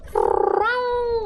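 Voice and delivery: high drawn-out cat mew; imitating cat meow. Owner imitating her cat's call for attention, high meow. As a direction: sing it like a cat wanting attention. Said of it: "he sits there and he goes".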